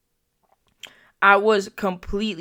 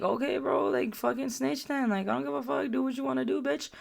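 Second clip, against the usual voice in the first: higher-pitched, acted-out brush-off; dismissive retort; 6 semitones higher, 4 dB quieter than usual. Storyteller replays telling a girl to just snitch, dismissive. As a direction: retell it as an offhand, higher-voiced dismissal.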